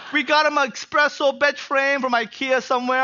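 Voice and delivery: normal talking voice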